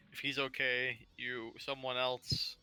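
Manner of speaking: ordinary delivery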